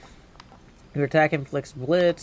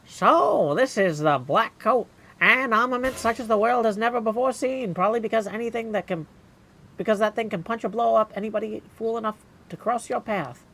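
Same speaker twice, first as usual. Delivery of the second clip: higher, grand announcer voice reading the weapon text; dramatic item read; 8 semitones higher, 3 dB louder than usual. Acted. Gamer reading weapon lore in a grand voice. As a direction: sell the weapon like a trailer voiceover.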